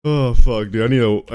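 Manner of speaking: ordinary talk, nothing marked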